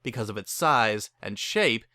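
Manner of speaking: usual speaking voice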